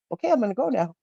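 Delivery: a hint of a shy dragon taking leave; cute little dragon. The cute little fiery dragon says goodbye, lightly voiced. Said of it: "climbed out and was like".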